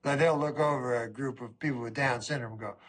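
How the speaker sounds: normal talking voice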